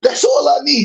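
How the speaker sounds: high, proud retort; defiant. Acted. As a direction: throw it back, cocky and high.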